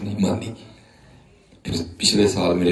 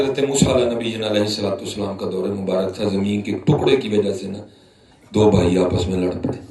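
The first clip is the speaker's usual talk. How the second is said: ordinary talk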